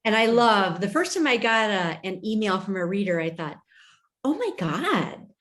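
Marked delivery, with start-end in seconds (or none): 4.2-5.3 high, slow and delighted; thrilled. Retold or imitated. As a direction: relive the thrill of the first fan mail. First email from a reader.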